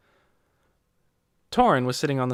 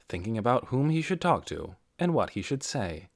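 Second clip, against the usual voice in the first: usual speaking voice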